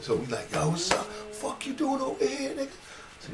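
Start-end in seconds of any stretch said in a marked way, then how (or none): 0.5-2.7 high, excited street greeting; lightly voiced old friend, surprised; Butch greeting. Butch surprised to see his friend, high excited greeting.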